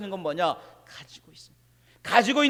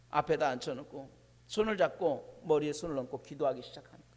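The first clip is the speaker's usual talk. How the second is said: usual speaking voice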